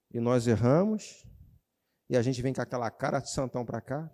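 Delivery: ordinary talk, nothing marked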